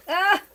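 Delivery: short, high, loud yelp; sharp real exclamation of alarm; startled cry. Real, not acted. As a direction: cry out suddenly. Sudden cry while cooking.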